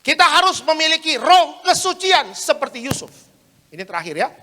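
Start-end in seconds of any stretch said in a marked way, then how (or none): 0.0-3.3 loud, high, slow declaration; preaching proclamation. Acted. Preacher declaring the third point about Joseph's purity, slow proclamation. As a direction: proclaim it word by word to the hall.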